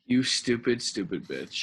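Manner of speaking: hammed-up mocking jeer; mock insult. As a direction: drop into a mocking voice for the second stupid. Mocking a character's death.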